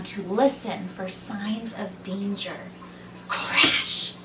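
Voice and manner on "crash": sudden, rasped sound-effect word. A narrator's reading. Narrator gives the sound behind the rabbit, sudden crash. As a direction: burst out the crash as a noise. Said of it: "He heard a loud sound".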